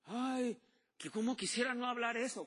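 mock lament. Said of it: "mocking a regretful believer".

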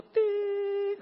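high beep imitation; monitor beep. Preacher imitating the flatline monitor, high beep. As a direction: mimic the hospital monitor's tone.

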